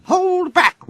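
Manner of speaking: ordinary delivery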